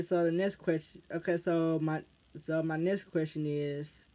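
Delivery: normal talking voice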